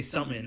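"something" said as "sumin", no th or g; dropped-g pronunciation. Shown as a demonstration. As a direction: reduce "something" to a folksy "sumin". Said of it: "I never say that in real life".

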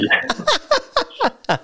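high, hearty laugh; burst of laughter. Real, not acted. Laughing after faking an emergency call to Michelle, real burst of laughter. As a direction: break up laughing.

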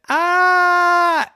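long high drawn-out ah; a slow, high wail as he tips over; falling cry. Acted. Boy falls from the tree, wailing.

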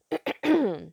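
loud sharp throat clear. Real, not acted. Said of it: "Pardon me, y'all".

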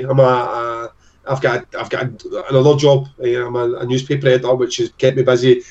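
ordinary talk

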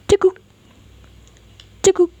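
high sharp hiccup sounds; scared hiccups. Acted. Frightened boy hiccupping in fear, high. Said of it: "makin sering dia cegukan".

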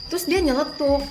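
normal talking voice

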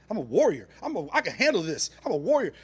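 loud mock-heroic pep talk; hammed-up warrior boast, rising in pitch; self-hyping bravado. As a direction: psych yourself up forcefully. Hyping himself up.

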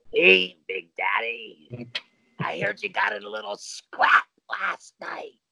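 slow gravelly wrestler growl; Road Warrior Hawk voice. Retold or imitated. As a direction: drawl it like a hulking tag team brawler. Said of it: "In that Road Warrior Hawk voice".

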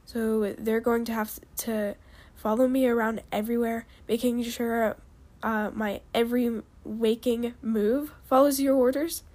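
ordinary delivery, nothing marked

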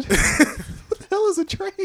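put-on high, slow kid question; squeaky confused boy; child voice. Voicing a young son.

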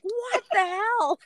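very high, drawn-out disbelief; mock shock. Waking to find her eyes swollen, comically appalled. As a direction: squeal it slowly, aghast at the sight.

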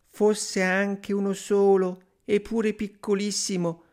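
high, yearning woman's voice; long for it in a high voice; wistful sighing wife. A narrator's reading. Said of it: "rispose la donna sospirando". (moglie sospirosa, voce acuta)

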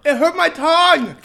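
high, slow wail; drawn-out high complaint of real pain; pained whine. Real, not acted. Complains of burnt tongue.

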